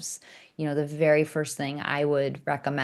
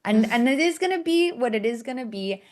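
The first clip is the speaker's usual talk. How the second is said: higher and louder mock fury; hammed-up rage; 6 semitones higher, 3 dB louder than usual. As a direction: ham up a murderous threat, half laughing. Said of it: "basically had a rage attack".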